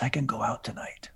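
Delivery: unvoiced whisper to himself; whispered thought. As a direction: breathe it out like a secret plan.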